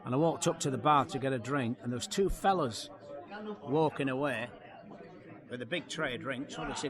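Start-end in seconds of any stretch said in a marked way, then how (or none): none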